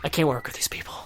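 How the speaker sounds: exasperated whisper aside; whispering Fred. Retold or imitated. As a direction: grumble it under his breath. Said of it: "And Fred's like".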